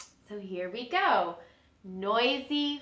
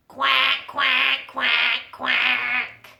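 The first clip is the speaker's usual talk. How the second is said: duck quacks; 3 semitones higher, 4 dB louder, much slower than usual. Acted. Mama duck quacking in song.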